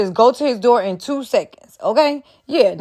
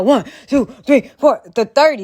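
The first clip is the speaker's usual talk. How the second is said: counting compressions. Shown as a demonstration. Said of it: "compressions now".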